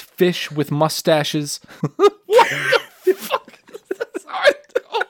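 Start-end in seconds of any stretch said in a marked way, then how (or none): 2.3-5.0 laughing disbelief. Real, not acted. Incredulous laughing outburst.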